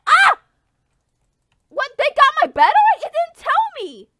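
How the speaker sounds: high, fast outraged cries; squealing disbelief; shocked yelp. Real, not acted. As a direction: blurt out the outrage. Ambushed unexpectedly.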